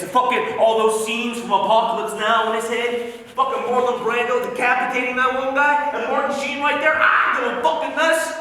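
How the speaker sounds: loud, high, building agitation; worked up to fever pitch; frenzied retelling. Acted. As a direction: rattle off the film horrors, climbing to a near yell. Frenzied retelling of war film scenes.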